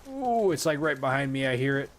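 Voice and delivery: high, loud alarmed swearing; panicking gamer. Real, not acted. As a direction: blurt it in sudden alarm. Crab right behind player.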